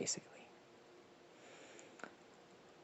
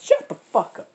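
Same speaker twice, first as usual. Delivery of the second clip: much higher, louder snapping fox voice; fox yelling from cage; 10 semitones higher, 7 dB louder than usual. Acted. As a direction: shout it back from the cage, irritated. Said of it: "goes back into his cage and says".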